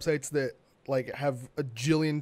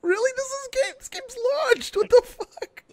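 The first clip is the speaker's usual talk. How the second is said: much higher, louder and rising; incredulous outburst; 10 dB louder than usual. Retold or imitated. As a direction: yelp in disbelief, pitch shooting up. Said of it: "I was just like".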